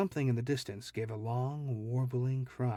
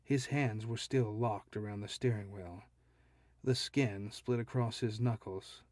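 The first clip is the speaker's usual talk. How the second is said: ordinary delivery, nothing marked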